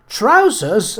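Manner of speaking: high, loud scandalised gasp; shocked gasp. A narrator's reading. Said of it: "Amelia and Harriet gasped together".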